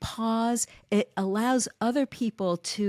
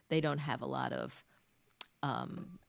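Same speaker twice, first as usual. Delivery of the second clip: ordinary talk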